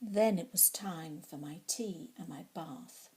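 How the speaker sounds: plain reading voice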